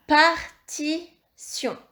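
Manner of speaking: "Partition" in three slow, separate syllables; syllable-by-syllable dictation. Shown as a demonstration. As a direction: dictate "Partition" slowly, pausing as par, ti, sion. Teacher dictates word syllable by syllable.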